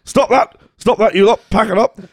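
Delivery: mock scolding. Cartoon man tells the noisy clouds to stop, mock scolding. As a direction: bark it at the rowdy clouds.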